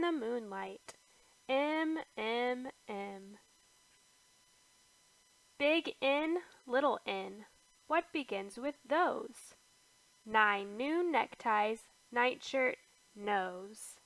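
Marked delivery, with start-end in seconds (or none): none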